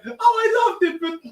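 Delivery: high, crying ghost voice; ghost whimper. Acted. Sonny's ghost sobbing back at Cher. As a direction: whine like a sad ghost.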